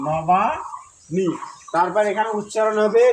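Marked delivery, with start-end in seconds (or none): 0.1-1.3 নবানি stretched very slowly; slow careful pronunciation. Shown as a demonstration. Teacher models নবানি for students, very slow. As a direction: draw out নবানি syllable by syllable. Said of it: "নবানি ঠিক থাকবে".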